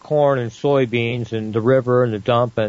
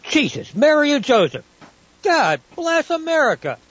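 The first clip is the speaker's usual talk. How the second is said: breathless exclamation; 13 semitones higher than usual. Acted.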